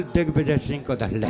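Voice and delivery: low, mock-solemn baba; a lightly voiced godman's low verdict; baba's voice. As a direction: drop into the old holy man's voice. Baba's reply, comic punchline.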